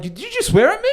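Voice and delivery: very high, loud mock-affronted lady; outraged accusation. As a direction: imitate her scandalised challenge, way up in pitch. Quoting the offended lady again.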